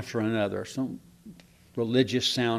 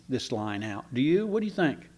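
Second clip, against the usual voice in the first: usual speaking voice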